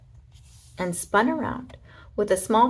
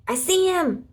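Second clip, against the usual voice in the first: high, louder excited shout; 10 semitones higher, 3 dB louder than usual. A narrator's reading. Space ranger shouts on spotting the hamster, high and loud. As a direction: call it out loud. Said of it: "shouted Buzz".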